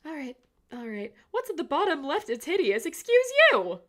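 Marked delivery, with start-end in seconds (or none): 2.9-3.8 high, loud indignant squawk; mock offended. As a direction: act insulted. Offended at being called hideous.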